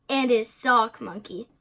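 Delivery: usual speaking voice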